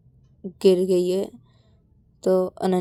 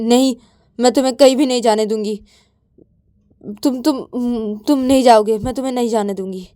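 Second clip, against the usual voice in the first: insistent refusal; 4 semitones higher, 7 dB louder, faster than usual. Acted. Blocks Ananya from leaving.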